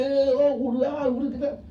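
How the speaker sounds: loud teasing street-celebration hollers, drawn out at the end; playful imitation of rowdy shouting; mock rowdy shouting. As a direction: ham up a partygoer yelling, slowing on the last calls. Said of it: "start shouting".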